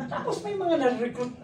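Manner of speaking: ordinary delivery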